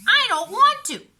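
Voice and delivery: put-on high, loud whiny child voice; a stubborn little sister refusing; whiny little girl. A narrator's reading. Beatrice voiced by narrator.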